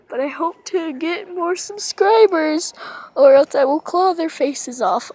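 fierce cat voice. Pretend. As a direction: voice the cat threatening the viewers. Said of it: "I'm a fierce cat".

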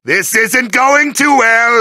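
usual speaking voice

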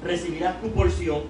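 ordinary talk

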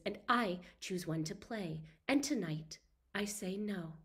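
measured, slightly hushed novel reading; story reading. Acted. Author reads her new novel to a live audience, in measured narration. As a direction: read the passage aloud like an audiobook.

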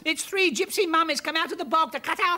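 high, loud panicked cry of murder; terrified shriek. Acted. Mother panicking at muddy figures, terrified.

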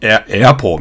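エアポー opening on a wide 'æ'; American A. Shown as a demonstration. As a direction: say エアポー as English apple. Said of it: "みたいな感じなんですよね".

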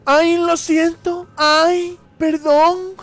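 ¡Ay, lo siento! agudo, fuerte y lento; disculpa exagerada. Pretend. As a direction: pide perdón con teatro. Disculpa exagerada en voz aguda. (disculpa teatral y chillona)

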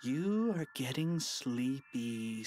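slow, drawn-out hypnotist voice; playful stage-hypnotist drone; mock hypnotist. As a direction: lull him slowly into a trance. Boy hypnotizing principal.

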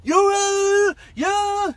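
high, loud yell in his face; drill sergeants yelling. Retold or imitated. Three drill sergeants in recruit's face, loud yell. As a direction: scream right in the recruit's face. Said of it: "they were all spinning my face and telling me".

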